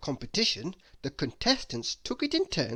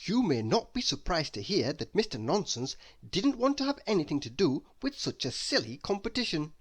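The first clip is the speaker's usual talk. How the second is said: ordinary delivery, nothing marked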